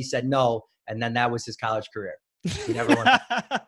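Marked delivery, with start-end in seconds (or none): 2.4-3.7 high, slow, laughing through the words. Real, not acted. Son laughing at dad's lost college career. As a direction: laugh it out in disbelief.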